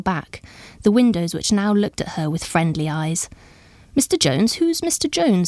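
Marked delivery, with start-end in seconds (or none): 3.9-5.5 higher-pitched puzzled query; puzzled question. A narrator's reading. Guest to Lady Jane about an unknown name, puzzled asking. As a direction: ask it puzzled, voice jumping up high. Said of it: "the other asked".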